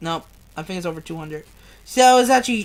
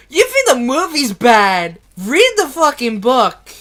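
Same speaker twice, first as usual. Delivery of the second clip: loud, emphatic challenge; real exasperated outburst, hit hard; indignant rant; 5 dB louder than usual. Real, not acted. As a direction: throw it loudly at a doubter. Upset reader rants at imagined doubters.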